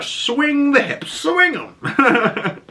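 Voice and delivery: very high, hammed-up urging; playful high-pitched command; mock exhortation. Mock advice from journal, hammed up.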